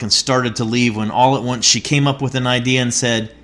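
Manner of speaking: ordinary talk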